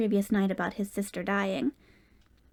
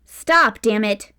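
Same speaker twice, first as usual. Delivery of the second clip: rising frustrated shout; yelling; 7 dB louder, much slower than usual. A narrator's reading. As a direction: yell at him to halt. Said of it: "she yelled".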